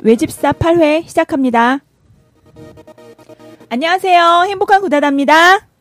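loud, high bright host greeting; energetic host opening. Acted. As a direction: open the show with big energy. Final retake of opening.

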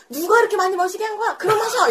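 loud, high, laughing teasing call; teasing shout. Retold or imitated. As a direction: tease her loudly while laughing. Senior teasing her red face.

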